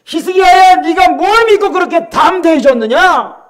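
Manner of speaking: high, drawn-out taunting call; mocking shout. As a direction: shout a jeer up at the city. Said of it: "히스기야를 조롱하면서 외치는 거예요". (조롱하듯 높고 길게 외치는 목소리)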